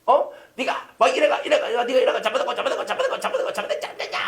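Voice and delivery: hammed-up scolding, rising high with laughter; mock scolding father. Father scolding his kids, mocked in a laughing high scold. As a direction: scold like a fussing dad, half laughing. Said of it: "애들도 훈계를 한대".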